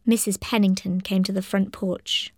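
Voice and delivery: usual speaking voice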